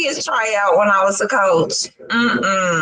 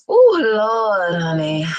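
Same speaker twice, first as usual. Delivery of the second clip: ordinary talk, nothing marked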